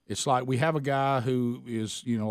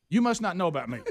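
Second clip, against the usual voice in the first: mock coach warning; 6 semitones higher, faster than usual. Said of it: "Hey, son, what's your name?".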